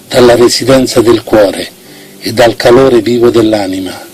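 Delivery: ordinary delivery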